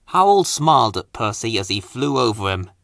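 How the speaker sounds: usual speaking voice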